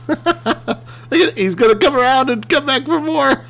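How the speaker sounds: high-pitched giddy commentary; giddy excitement. Real, not acted. Player watches his machine circle back, giddy. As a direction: call it out high, between laughs.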